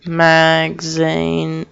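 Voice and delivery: very slowly stretched syllables; slow, syllable-by-syllable delivery; drawn-out word. Real, not acted. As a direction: drag out each syllable of "magazine". Stretches word, then says it normally.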